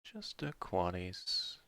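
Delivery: quiet, stunned echo; hushed awe. Real, not acted. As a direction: murmur it in disbelief. Repeats four of a kind.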